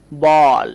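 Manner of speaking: "বল" loud, with a drawn-out "aw" vowel; demonstrated pronunciation. Shown as a demonstration. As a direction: stretch the "অ" in "বল" for learners. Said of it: "বল উচ্চারণ করব".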